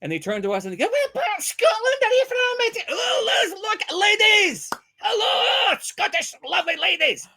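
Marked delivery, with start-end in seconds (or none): 0.8-2.8 put-on very high caricatured Scottish old lady; imitated Scottish ladies, piercingly high; Scottish older ladies. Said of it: "that are all Scottish". 2.9-7.3 very high, hollered mock-Scottish greeting; over-the-top Scottish accent, drawn out. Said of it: "we're continuing doing our Scottish".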